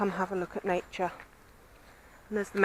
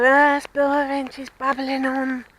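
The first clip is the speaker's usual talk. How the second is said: drawn-out, higher-pitched imitation of moaning; mock bored complaint; 4 semitones higher, 5 dB louder, slower than usual. Imitating viewers complaining about her babbling, slow mock moan. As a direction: drag out a mock whine. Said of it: "most people go".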